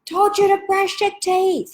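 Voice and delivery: high, slow, exasperated nag; nagging mum. Retold or imitated. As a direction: scold the kids way up high. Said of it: "I'm one of those mums".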